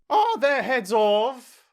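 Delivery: loud, high, slow royal demand; shouted question. A narrator's reading. Queen checking on the executions, shouting. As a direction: shout it high, stretching the words. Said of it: "shouted the Queen".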